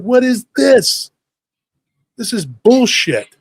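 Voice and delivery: high, slow, drawn-out disbelief; indignant. Retold or imitated. Kid objecting to equal candy split, slow indignant protest. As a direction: stretch out each word in outrage.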